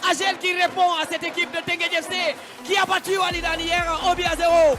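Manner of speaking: loud live commentary; excited play-by-play. Real, not acted. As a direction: hype up the goal call.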